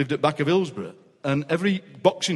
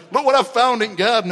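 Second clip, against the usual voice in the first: a lightly voiced miner, a touch higher and louder; a hint of a thrilled pit lad; excited miner; 5 semitones higher, 4 dB louder than usual. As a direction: burst in with a find, mildly hammed-up. Coal miner voiced in story.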